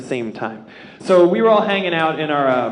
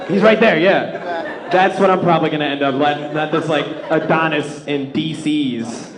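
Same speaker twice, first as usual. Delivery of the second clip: ordinary talk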